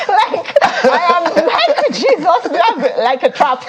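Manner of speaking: high-pitched, laughing words; real laughter. Real, not acted. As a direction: talk while cracking up. Cracking up at the joke.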